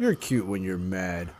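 low, slow, soft imitation. Host retelling the commercial's punchline, low slow deep-voiced imitation. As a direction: drop down deep and take it slowly. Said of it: "I got a deeper voice".

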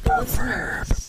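mock ASMR whisper. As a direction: whisper it slowly. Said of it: "Stop A S M R ing".

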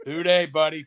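normal talking voice

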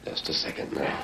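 tense whisper; hushed suspense. Acted. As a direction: breathe it, don't be heard. Hiding, about to spring trap.

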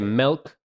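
milk said with an E, "melk"; imitated "melk". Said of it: "Some people say milk".

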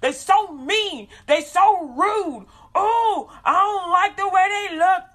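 slow, high, loud mock complaining; hammed-up slow whine of dislike; whiny disgust. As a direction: drag out the grievance like a sulky hater. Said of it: "'Cause you're like".

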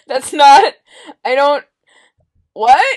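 laughing. Real, not acted. Laughing too hard to talk.

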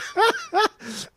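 high giggling burst; high-pitched laugh. Real, not acted. Laughing at his own mock cover of the song, high giggle.

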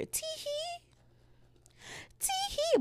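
very high, soft "tee-hee" giggle; mock giggle. Showing how she giggled at night, tiny high giggle.

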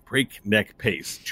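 "Breakneck pace" slowly, each word distinct; slow, careful repeat. Real, not acted. Narrator repeating "Breakneck pace", slow and clearly articulated. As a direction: stretch out "Breakneck pace" deliberately.

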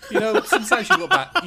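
high, loud laughing squeal. Real, not acted. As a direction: squeal it through embarrassed laughter.

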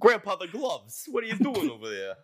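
put-on high, loud New York accent. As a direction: bark it like a New York mobster. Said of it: "You gotta say it with a New York accent".

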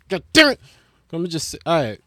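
ordinary talk, nothing marked

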